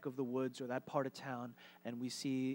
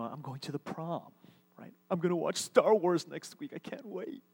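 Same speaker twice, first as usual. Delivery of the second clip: mock giddy teen; 2 semitones higher, 3 dB louder than usual. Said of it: "you get a little giddy, a little excited".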